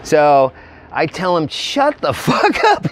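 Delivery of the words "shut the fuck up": high, slow, laughing through it; laughing retort. Retold or imitated. Narrator snapping at panicked friend, slow high laughing retort. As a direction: crack up while telling him to shut up.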